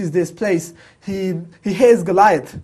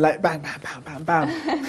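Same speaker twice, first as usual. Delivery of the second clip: mock fight noises; 4 dB quieter than usual. Retelling the Avengers film, playful smash sounds. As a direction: play out the Hulk's punches for fun. Imitates Hulk smashing people.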